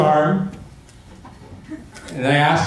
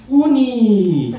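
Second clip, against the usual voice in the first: put-on toddler voice; 6 semitones higher than usual. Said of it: "he goes".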